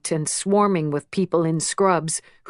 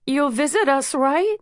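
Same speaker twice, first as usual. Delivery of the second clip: uneven voice; 7 semitones higher than usual. A narrator's reading. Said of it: "Lucas says unevenly".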